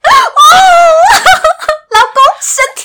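mock moaning. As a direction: overplay a breathless wife in bed. Mock bedroom moans again. (夸张地模仿床上呻吟)

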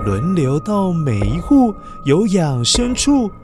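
ordinary delivery, nothing marked

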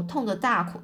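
ordinary delivery

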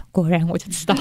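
knowing laugh while saying it. Retold or imitated. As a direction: laugh through the line. Acted inner thought, laughing. (笑着说果然我就知道)

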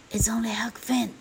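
high, breathy stage whisper; secretive storybook boy's whisper; hushed whisper. A narrator's reading. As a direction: whisper your answer through the door. Boy answers hushed at the door.

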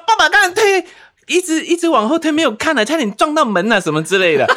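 high, loud, breathless mom voice; mock flustered mom. The mother telling her son the father nearly hit the door, high and flustered. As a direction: gush it out all flustered. Mom recounting near crash.

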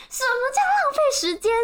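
very high, loud mock outrage; retold outraged retort. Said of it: "他就深一口气".

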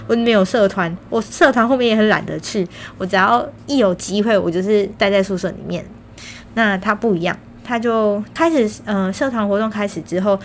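usual speaking voice

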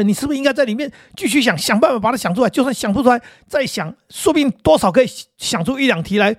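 high, pushy lecturing dad voice; nagging father. Retold or imitated. Father scolding his son for handing in the exam early, high and insistent. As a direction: pile on the pressure like a scolding father. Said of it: "他爸说".

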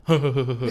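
tight little giggle held back behind the lips; stifled giggle. Shown as a demonstration. Showing friends how she had to giggle while brushing teeth, stifled. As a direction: laugh with a mouth full of toothpaste, stifled. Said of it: "所以你不能太大声".

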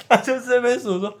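laughing. Real, not acted. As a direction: laugh through the line. Laughing while telling the story. (边说边笑)

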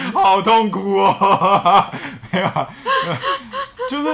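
words squeezed out through laughter; laughing. Real, not acted. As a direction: laugh through it, high and slow. Laughing while admitting sweet talk.

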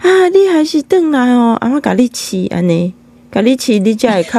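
a hint of fussing grandma, easing down. Grandma fussing over her returning grandson, lightly imitated grandma. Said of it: "然后阿嬷就说".

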